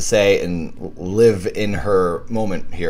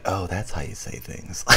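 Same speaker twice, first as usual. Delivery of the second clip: hushed awe; 11 dB quieter than usual. Retold or imitated. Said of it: "be like".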